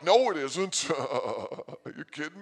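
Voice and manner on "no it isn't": high and loud, flat denial; loud high retort. Retold or imitated. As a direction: shoot him down loudly. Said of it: "And I'm like".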